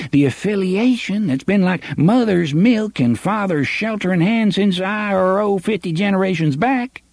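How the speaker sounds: high, wheedling small-town sheriff; oily official buttering up the boys; pompous sheriff voice. Acted. Sheriff pleads with Jonas.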